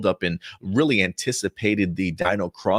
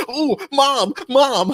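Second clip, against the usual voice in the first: high-pitched imitation of a thrilled son; excited mama's boy; 4 dB louder, much slower than usual. Son spotting his parents at the airport, mock-excited mama's boy. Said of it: "pure mama's boy mode".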